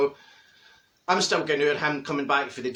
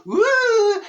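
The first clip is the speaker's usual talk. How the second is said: high, loud whoop of delight; excited whoop; 7 dB louder than usual. Retold or imitated. Crowd cheering as Marjorie walks in, whooping loud. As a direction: cheer like the crowd spotting her. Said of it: "folk just go".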